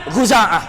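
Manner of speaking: tribesman's "Khuza'ah" with gh for kh; ghain pronunciation. Shown as a demonstration. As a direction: answer "Khuza'ah" starting on a voiced throaty gh. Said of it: "pakai ghin".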